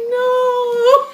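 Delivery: high, loud, drawn-out wail; hammed-up tearful protest; mock crying. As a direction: wail it in pretend heartbreak. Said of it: "made me cry".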